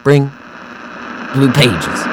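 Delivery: high, loud, broken-up demand; crazed man pleading. Acted. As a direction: grasp at the words through static. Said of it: "He spoke erratically with a crazed smile".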